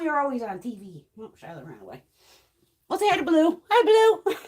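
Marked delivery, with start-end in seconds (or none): 2.9-4.3 high, louder excited greeting; pet talk. Real, not acted. Introducing her cat Blue, high excited pet voice.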